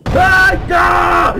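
very high shout of fright; panicked scream. Real, not acted. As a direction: scream for it to stop. Susto, pide que pare. (grito agudo de pánico)

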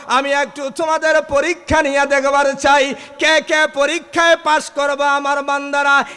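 high, loud chanted sermon cry; preaching at full force. Acted.

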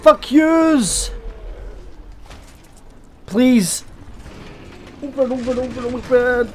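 shouting in panic as a door locks; panicked shouting. Real, not acted. Panicked yelling at a locked door.

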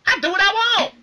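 very high, loud bratty yell; mock defiant shout. Imitating Maria defying Santino, high loud bratty yell. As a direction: squeal it like a defiant diva.

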